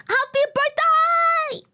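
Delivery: high, slow sung greeting that cracks; voice cracking. Real, not acted. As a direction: stretch it high until the voice breaks. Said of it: "Oh, the voice crack".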